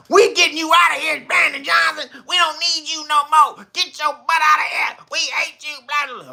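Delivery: high, loud mock yelling at the mayor; mock emotional shouting. Council speakers yelling at the mayor, mock angry shouting. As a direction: shout like a furious heckler at the mic.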